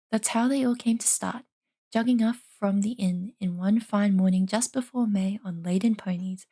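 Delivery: plain reading voice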